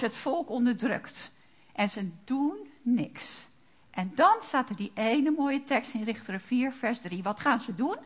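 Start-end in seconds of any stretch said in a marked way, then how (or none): none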